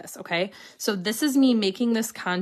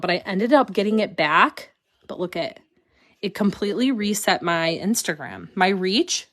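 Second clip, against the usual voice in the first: ordinary talk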